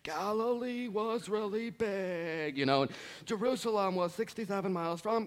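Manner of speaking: lightly voiced dull lecturer droning on; mock professor. Preacher mocking a dry professor lecture to the congregation, droning. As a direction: imitate a boring professor reading facts.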